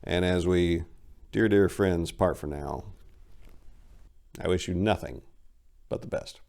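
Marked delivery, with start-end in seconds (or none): none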